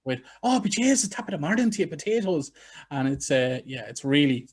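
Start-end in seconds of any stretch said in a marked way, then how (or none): 0.4-2.5 put-on awful stage-Irish accent, pitched way up; hammed-up fake Irish, loud high lilt; mock a terrible Hollywood Irish brogue; Tom Cruise. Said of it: "the worst Irish accents in the history of cinema".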